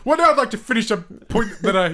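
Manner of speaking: mocking a friend, laughing; mocking echo through laughter. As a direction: giggle out a mock repeat. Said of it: "mocking Jordan's line".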